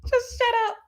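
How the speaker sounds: high laughing squeal; real laughter, high; laughing hard. Real, not acted. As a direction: laugh until the words crack. Laughing at her own rant.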